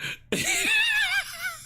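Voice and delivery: high helpless cackle; high cackling laugh. Real, not acted. Streamer losing it again, high cackle. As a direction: cackle uncontrollably.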